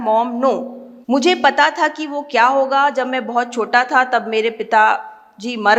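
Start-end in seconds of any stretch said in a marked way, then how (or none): none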